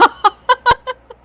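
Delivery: high burst of laughter; loud shrieking laugh. Real, not acted. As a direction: howl with laughter. Laughing at gross story.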